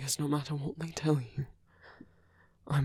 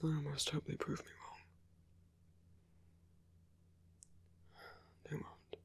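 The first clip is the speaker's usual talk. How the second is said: very soft, half-whispered musing; hushed soliloquy; 11 dB quieter than usual. Acted. Rebel alone planning to storm the headquarters, hushed soliloquy. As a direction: think aloud under your breath, barely voiced. Alone, muttering as guards approach.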